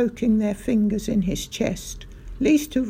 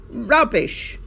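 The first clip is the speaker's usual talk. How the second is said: loud dismissive yell; 4 semitones higher, 5 dB louder than usual. A narrator's reading. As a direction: yell it in contempt. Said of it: "yelled Mister Hazel".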